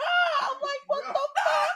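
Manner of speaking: very high panicked squeal, half laughing; mock screaming. Rider retelling a shared scare, mock screaming. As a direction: squeal high as if flung down a slide. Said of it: "when I hear her scream".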